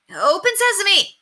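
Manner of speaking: high, slow, loud magic command; loud high drawn-out yell. A narrator's reading. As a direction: shout it just in case it works. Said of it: "yelled Henry".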